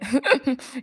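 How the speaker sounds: brief embarrassed chuckle; a quick self-conscious laugh; short laugh. Real, not acted. Laughs at her own crying.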